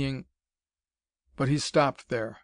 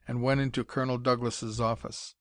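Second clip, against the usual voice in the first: ordinary delivery, nothing marked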